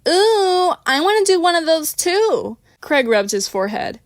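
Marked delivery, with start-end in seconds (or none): 0.1-2.6 high, loud excited call; yelling from kitchen. A narrator's reading. Christina calling from the kitchen to join in, loud. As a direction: shout across the house, eager.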